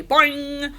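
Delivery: high, ringing single boing; frog boing noise. A narrator's reading. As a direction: twang it out like a leaping frog. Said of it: "Og jumped for joy".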